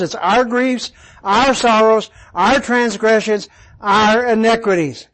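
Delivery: "our" hit at the head of each item; repeated, even stress on "our". Shown as a demonstration. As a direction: list them, punching every "our". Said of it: "is on the plurals".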